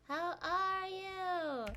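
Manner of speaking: high, very drawn-out greeting; effusive greeting. Real, not acted. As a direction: stretch every word out in an effusive welcome. Repeats greeting to arriving viewer, exaggerated.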